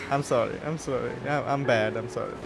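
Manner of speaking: ordinary talk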